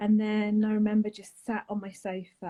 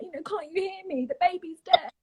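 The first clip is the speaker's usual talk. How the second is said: retold angry outburst; 9 semitones higher than usual. Shouting the news at mum on the phone, retold anger. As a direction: let the frustration burst out. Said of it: "I was angry".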